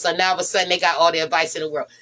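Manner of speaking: ordinary talk, nothing marked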